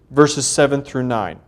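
ordinary delivery

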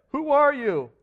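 a high, loud cry upward; startled cry. Acted. Saul struck blind on the road cries out to the voice, loud and high. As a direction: call out to the unseen voice.

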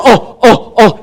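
high mock barking "oh"s; repeated imitated throat barks; seal-like noise. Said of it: "Remember, we went to SeaWorld?".

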